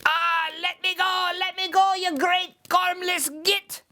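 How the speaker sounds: high squealing little leprechaun, struggling; screaming leprechaun. Acted. Caught leprechaun screams at Seamus, high and frantic. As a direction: kick and squeal to be let go. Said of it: "kicking and screaming".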